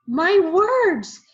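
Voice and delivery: high, loud alarmed shout; child shouting in dismay. A narrator's reading. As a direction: cry out in alarm that they have his words. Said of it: "Donovan shouted".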